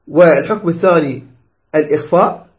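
usual speaking voice

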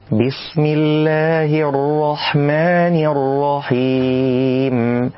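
slow, drawn-out chanted recitation; tajwid recitation. Shown as a demonstration. Teacher recites the correct basmala.

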